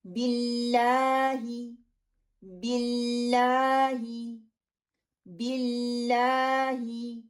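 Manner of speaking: बिल्लाही very slowly with firm double "l"; slow practice recitation. Shown as a demonstration. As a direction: chant बिल्लाही slowly for students to repeat. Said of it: "आप भी साथ साथ पढ़िए".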